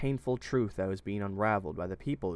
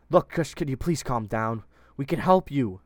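insisting; 5 semitones higher, 8 dB louder than usual. A narrator's reading. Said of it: "Otis insisted".

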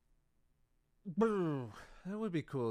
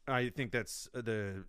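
ordinary talk

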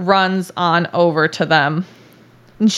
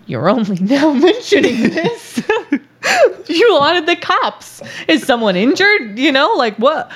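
higher-pitched, incredulous imitation of an operator; 6 semitones higher than usual. 911 operator to caller about the blood, incredulous. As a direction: play a dispatcher who cannot believe it.

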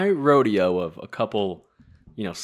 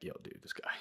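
hushed, confiding whisper; whispered gossip; 19 dB quieter than usual. Retold or imitated. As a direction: lean in and whisper it to a buddy. Retelling a secret complaint to a friend.

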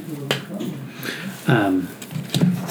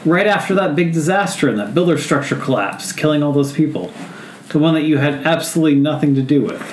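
higher, pointed creature voice; accusing creature; 5 semitones higher than usual. Acted. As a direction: needle the wizard with it. Small creature accuses the wizard.

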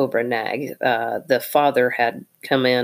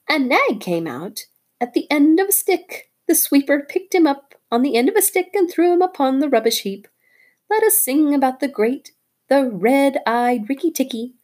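high chirpy bird voice, bragging; a tailorbird crowing over the dead snake; excited little bird; 11 semitones higher, 3 dB louder than usual. Acted. Darzee the bird talking.

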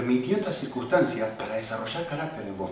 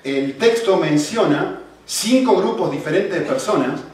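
full-force preaching; 6 semitones higher, 12 dB louder than usual. Acted. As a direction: preach it out loud to the whole church. Preaching to the congregation.

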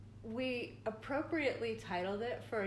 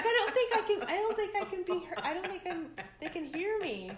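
high, raised voice with laughter; retold yell, pitched up as if unheard; yelling into the car phone; 9 semitones higher, 5 dB louder than usual. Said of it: "Why do you have to yell?".

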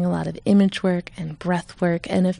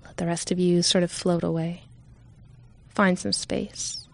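calm, unhurried meditation voice with long pauses; guided meditation. Real, not acted. Leader guiding listeners to settle in, calm meditation voice. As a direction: ease listeners gently into stillness.

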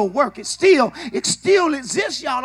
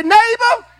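loud, high preacher's cry to the neighbor; shouted call-and-response; 7 semitones higher, 6 dB louder, slower than usual. Shown as a demonstration. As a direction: belt it out to the person beside you. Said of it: "look at your neighbor and say".

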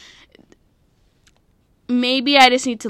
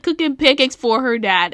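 usual speaking voice

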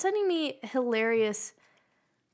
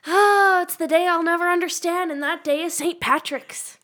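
dramatic announcement; 6 semitones higher, 9 dB louder than usual. Pretend.

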